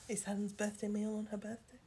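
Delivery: whisper. Real, not acted. Teasing aside to co-host.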